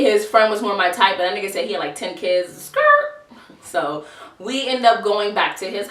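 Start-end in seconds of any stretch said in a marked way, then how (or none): 2.6-3.3 very high, loud screeching "skrrt"; comic high-pitched car skid noise; imitated tire screech. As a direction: squeal it like tires peeling away. Imitated tire screech, fleeing the idea.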